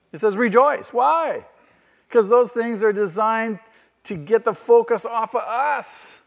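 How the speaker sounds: rising fervent sermon voice; full-force preaching. Acted. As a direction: drive the point home from the pulpit.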